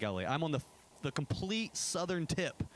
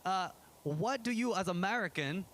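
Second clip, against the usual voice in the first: higher-pitched put-on Israeli accent; mock Israeli accent; 7 semitones higher than usual. Israeli high schoolers quizzing the pastor, in a higher mock Israeli accent. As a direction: imitate an Israeli teen asking the American. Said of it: "I have to give them some accent".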